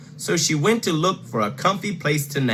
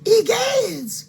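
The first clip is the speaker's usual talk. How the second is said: very high shocked gasp of a word; Mama Bear exclaiming; 3 dB louder than usual. Acted. Mama Bear shocked at her bed, very high. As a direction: clutch your pearls, squealing. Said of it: "cried Mama Bear".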